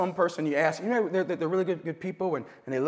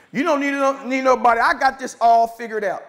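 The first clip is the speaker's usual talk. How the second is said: higher, louder cocky claim; mock boaster; 4 semitones higher, 5 dB louder than usual. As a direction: play the friend bragging he has it all figured out. Mocking a boastful friend.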